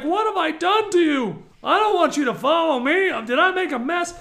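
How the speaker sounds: acted-out exasperated lament; exasperated complaint.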